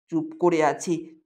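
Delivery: plain reading voice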